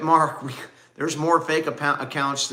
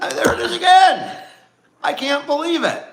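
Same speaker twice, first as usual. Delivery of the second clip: very high, louder exclamation; mock outrage; 13 semitones higher, 7 dB louder than usual. Husband reacting to wife's yawning, high hammed-up outrage. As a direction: overdo the disbelief, pitched way up.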